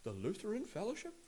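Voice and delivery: soft, baffled echo of the question; a quiet, bewildered old brother who never heard of it; mock puzzled elder, hushed. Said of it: "he would look at you and he would say".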